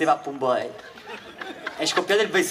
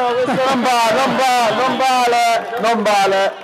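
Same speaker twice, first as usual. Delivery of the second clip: excited protest; 5 semitones higher than usual. Real, not acted. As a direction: protest it's not fair. Host protests a prompted answer.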